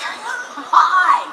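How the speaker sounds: high, laughing greeting; excited hi. Retold or imitated. Daughter greets her mom at the door, excited and laughing. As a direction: burst in with a delighted hi. Excited arrival at mom's house.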